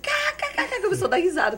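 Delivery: high, loud cá cá cá cackle; imitated laughter. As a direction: mimic the room laughing. (imitando a risada de todos)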